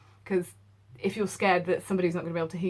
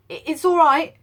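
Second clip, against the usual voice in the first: higher, louder urgent servant; anxious call; 10 semitones higher, 11 dB louder than usual. Acted. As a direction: call through the door to be let in. Servant calls through the door.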